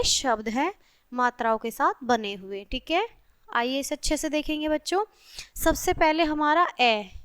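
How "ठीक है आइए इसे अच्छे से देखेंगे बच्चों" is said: ordinary talk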